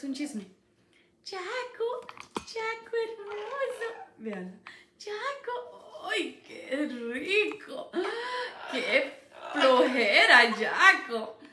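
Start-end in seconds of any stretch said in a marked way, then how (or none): 1.2-6.1 high, soft cooing at the parrot. Real, not acted. Mima al loro. 6.1-11.4 lazy groan. Real, not acted. Imita la pereza del loro.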